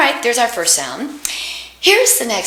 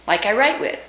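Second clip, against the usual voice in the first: normal talking voice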